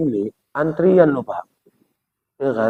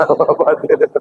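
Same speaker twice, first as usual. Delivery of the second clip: laughing. Real, not acted. Joking repeat of the term.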